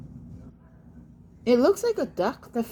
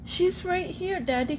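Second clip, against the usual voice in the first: normal talking voice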